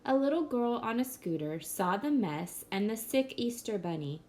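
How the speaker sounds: usual speaking voice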